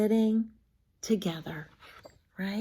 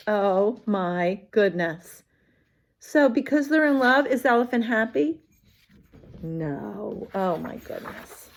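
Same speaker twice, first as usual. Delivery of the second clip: ordinary delivery, nothing marked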